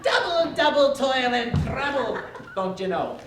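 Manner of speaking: hammed-up Scottish accent, laughing through it. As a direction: mangle Shakespeare in a comic Scottish accent. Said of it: "in perfect Scottish accents".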